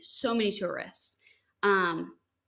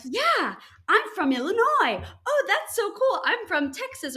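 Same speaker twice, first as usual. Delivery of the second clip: excited tourists; 11 semitones higher, 5 dB louder than usual. Retold or imitated. Two American tourists meeting excitedly.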